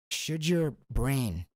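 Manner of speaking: very slow, drawn-out words; slow dramatic question. Acted. Host slowing right down to pose the question. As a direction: drag it out to build suspense.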